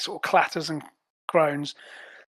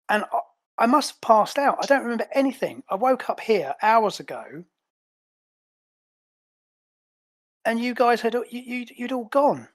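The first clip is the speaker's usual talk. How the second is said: higher-pitched, upset agent demanding answers; aggrieved and confused, pitched up; indignant Azure; 5 semitones higher than usual. Acted. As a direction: play a bewildered agent, voice raised in pitch.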